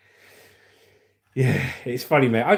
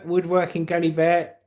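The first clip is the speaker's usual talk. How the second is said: ordinary talk, nothing marked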